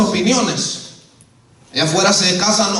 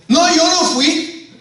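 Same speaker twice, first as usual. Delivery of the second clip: high, drawn-out denial; acted high-pitched denial; denying culprit; 7 semitones higher, slower than usual. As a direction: protest innocence, pitched well up. Acting out a denial.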